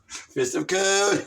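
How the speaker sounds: slow, loud announced "Fist of Cohn"; dramatic call. Acted. Roy Cohn summoning his guards, slow and loud. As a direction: proclaim it like a villain's summons. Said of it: "It's the fist of Cohn".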